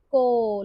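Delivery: को with ओ held long; long vowel. Shown as a demonstration. Teacher confirming the long sound, को stretched. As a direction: draw को out on one vowel. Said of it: "long sound होता है".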